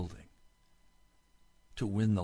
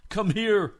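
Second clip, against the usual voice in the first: loud call; 11 semitones higher, 9 dB louder than usual. Acted. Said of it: "echoing the king's call".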